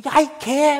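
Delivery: high, loud shouted curse; kid swearing shout. Retold or imitated. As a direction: yell it like a kid chasing friends. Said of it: "막 이러고".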